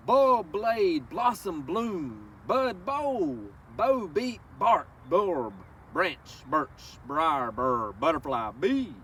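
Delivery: slow, lilting read-aloud of a rhyming book; reading to children. Acted. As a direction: savor each word for the little ones. Reads picture book to children.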